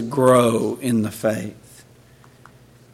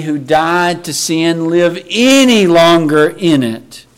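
loud, high, slow pulpit exclamations; emphatic preaching; 6 semitones higher, 9 dB louder, slower than usual. Acted. Preacher quoting Paul against continuing in sin, emphatic. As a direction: thunder the refusal, stretching the key words.

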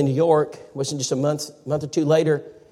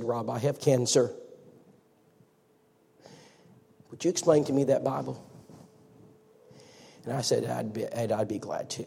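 hushed emotion; 4 semitones lower, 11 dB quieter than usual. Retold or imitated. Dying man asks, tender reply.

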